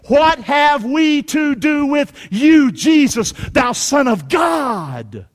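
loud, high, drawn-out cry; demons crying out. Acted. Demons cry out to Jesus, loud drawn-out shout. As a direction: cry it out slowly at full preaching force. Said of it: "cried out, saying".

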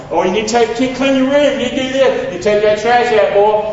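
dad laying down chores; nagging father. Retold or imitated. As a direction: rattle off chores at your son. Retold nagging father on the phone.

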